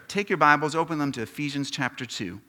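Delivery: ordinary delivery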